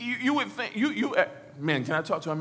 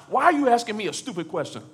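higher, louder, quick retort; exasperated, mask off; 5 semitones higher, 4 dB louder, faster than usual. Acted. Hurting person answering with the mask off, exasperated. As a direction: fire back at a silly question. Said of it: "if we take the mask off, this is how it sounds".

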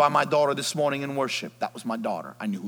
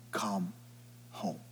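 soft, gentle call; tender; 6 semitones lower, 12 dB quieter than usual. Acted. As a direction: invite them home softly. Repeat soft invitation.

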